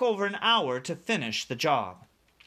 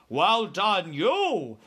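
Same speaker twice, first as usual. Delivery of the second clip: louder, swinging giant's voice; BFG praising; 4 semitones higher, 4 dB louder, much slower than usual. A narrator's reading. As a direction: praise with big, lilting emphasis. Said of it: "said the B F G".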